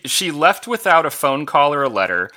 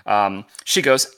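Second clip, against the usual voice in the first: ordinary talk, nothing marked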